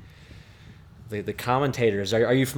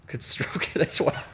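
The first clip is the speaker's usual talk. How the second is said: laughing; 5 semitones higher, 3 dB quieter than usual. Real, not acted. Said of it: "I can't say that without laughing".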